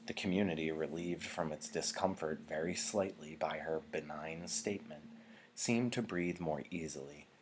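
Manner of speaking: ordinary delivery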